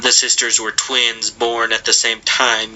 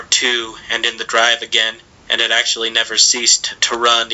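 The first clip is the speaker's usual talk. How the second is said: ordinary delivery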